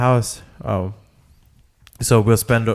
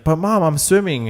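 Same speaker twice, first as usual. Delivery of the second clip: lightly voiced kid, pitched up; mock childish protest to a parent; 7 semitones higher than usual. Little boy to his mom in the pool, mild protest.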